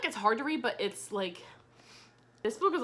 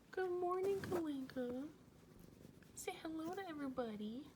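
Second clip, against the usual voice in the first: high, very soft coo; hushed, high-pitched cooing to a cat; pet talk; 6 semitones higher, 13 dB quieter than usual. Real, not acted. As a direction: greet the pet in a tiny high voice. Cooing morning greeting to pet.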